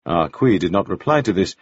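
plain reading voice

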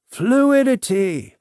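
high, drawn-out exclamation; mildly shouted mystic cry; restrained narrated shout. A narrator's reading. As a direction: proclaim it slowly, half shouting. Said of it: "he shouted out".

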